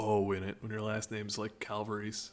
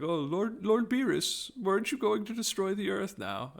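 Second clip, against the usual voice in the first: Whis voice; 9 semitones higher than usual. Retold or imitated. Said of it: "he's like".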